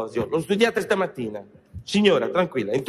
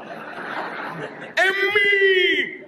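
high shout of mine; 11 semitones higher than usual. Shown as a demonstration. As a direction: yell it out, claiming it. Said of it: "No, tu devi dire". (gridato forte)

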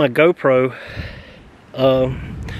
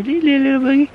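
high playful voice; 12 semitones higher, 4 dB louder than usual. Real, not acted. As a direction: coo it playfully, much higher. Pointing out child to camera.

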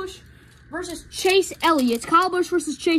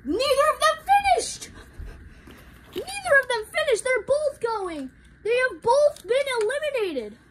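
high-pitched, disbelieving commentary; astonished race call; 7 semitones higher than usual. Real, not acted. Commentator seeing neither car finish, astonished. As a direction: announce the double knockout in high-pitched shock. Surprised both cars failed to finish.

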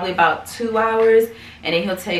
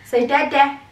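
put-on coaxing baby talk; 6 semitones higher than usual. Shown as a demonstration.

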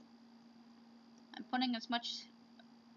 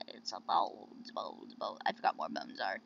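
very low goofy muttering; silly low voice; 3 dB quieter than usual. Pretend. Player fumbling for bones in the game, silly low voice. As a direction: drop far down in pitch, playfully.

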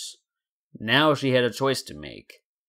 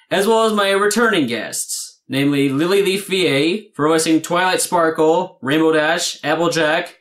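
bright, upbeat sign-off, a bit higher and louder; lively video outro; 6 semitones higher, 6 dB louder than usual. Real, not acted. Narrator thanks viewers and voice actors, upbeat outro. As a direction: wrap up the video cheerfully for the viewers.

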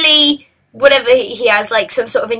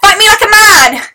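calling out; 8 semitones higher, 6 dB louder than usual. Real, not acted. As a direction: call out across the water. Hunting the opponent.

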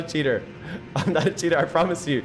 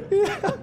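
high breathy laugh. Real, not acted. Laughing on stream.

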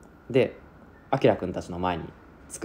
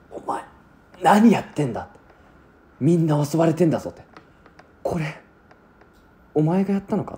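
higher and louder accusing shout; angry confrontation; 8 semitones higher, 5 dB louder than usual. Acted. Akira confronts Higure about the zombies, angry shout. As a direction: demand an answer from a traitor.

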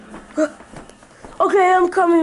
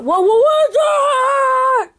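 shocked shout; 9 semitones higher, much slower than usual. Acted. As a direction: explode in disbelief. Shocked at dry bones attack.

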